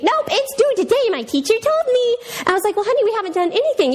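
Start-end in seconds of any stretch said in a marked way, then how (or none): 0.0-2.2 put-on high, insistent little girl; little daughter voice. Daughter's voice in story. 2.7-3.9 flustered mom. Retold or imitated. Mom panicking at news.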